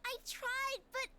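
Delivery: put-on high, teary child voice; tearful little girl. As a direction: hold back tears as a small girl. Child voice near tears.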